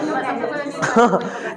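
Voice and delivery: short soft laugh at his own joke; amused laugh. Real, not acted. Teacher laughing at his joke about TV debates, soft chuckle. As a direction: let a soft chuckle slip out.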